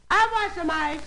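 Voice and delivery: high, put-on lady's voice; woman calling down. Upstairs lady calling to the ice man, high. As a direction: call down from the window as the lady. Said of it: "She yells down to the guy".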